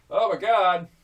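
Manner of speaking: ordinary talk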